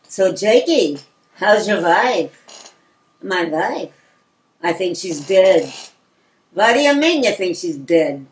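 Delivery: put-on deliberate Yiddish-accented exchange with pauses; unhurried, deadpan Yiddish-accented patter; old Yiddish-accented men. Acts two old men's dialogue.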